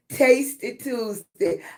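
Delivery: normal talking voice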